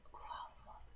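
whispered to herself; a soft aside whisper. Real, not acted. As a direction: whisper while trying to remember. Muttering to herself, recalling.

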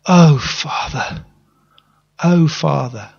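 slow, grieving call, mildly performed; mild grieving plea; retold crying out. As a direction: recall crying out at a father's sudden death. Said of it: "I cried".

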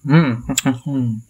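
slurping broth while talking; eating soup. Real, not acted. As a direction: mouth full of noodle soup. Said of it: "Seruput kuahnya dulu ya".

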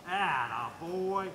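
very slow, stretched-out praise; drawn-out praise. Real, not acted. Trainer to dog reaching its place, slow drawn-out praise. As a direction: linger on each word to praise the dog.

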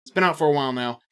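ordinary talk, nothing marked

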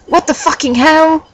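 high, mildly angry question; asked angrily. A narrator's reading. Ebony questioning Draco in the story, high understated anger. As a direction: pitch it up in mock outrage. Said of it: "I asked angrily".

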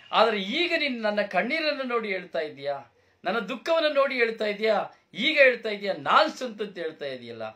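loud, high, hurt father scolding; hurt reproach. Acted. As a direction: confront him with his tears. Father loudly reproaching his son. (ನೋವಿನಿಂದ ಜೋರಾಗಿ ಮಗನನ್ನು ಪ್ರಶ್ನಿಸುವ ತಂದೆ)

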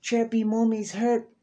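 high, coaxing put-on voice of a scheming parent; manipulative parent voice. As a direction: sweet-talk the robot into doing your bidding. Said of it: "they was like".

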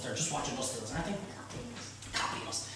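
breathy conspiratorial whisper; hushed joke to the viewers; whispered aside. Real, not acted. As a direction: lean in and whisper it like a secret. Conspiratorial aside about TV chefs.

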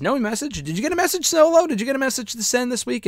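high, teasing voice aimed at Solo; mocking Sami taunt. Sami taunting Solo on air, high and teasing. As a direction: pitch it way up and needle him. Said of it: "And Sami says".

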